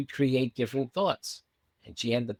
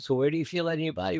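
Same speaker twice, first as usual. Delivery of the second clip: rattled off fast, as the usual routine question; rapid routine question; 4 semitones higher, much faster than usual. Retold or imitated. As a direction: fire it off quickly, as if asked a hundred times. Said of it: "like, normally, just".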